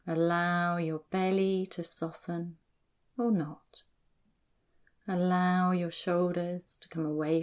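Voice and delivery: slow, soft guiding voice; calm grounding exercise. Acted. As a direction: lead them back to the body, unhurried. Quick run-through of the grounding exercise.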